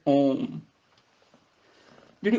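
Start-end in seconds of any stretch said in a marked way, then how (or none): 0.1-0.6 ਓਮ held with a nasal hum. Shown as a demonstration. Teacher showing the nasal sound, drawn-out nasal ਓਮ. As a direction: open the o and hum ਓਮ. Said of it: "ਉਹਦਾ ਨਾਸਕੀ ਉਚਾਰਨ ਹੋਏਗਾ".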